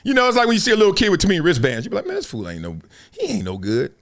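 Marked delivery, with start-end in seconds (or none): 2.1-3.9 low, hushed scoff; retold dismissive aside. Runner sizing up a kid in wristbands, dismissive. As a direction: write off a rival under your voice.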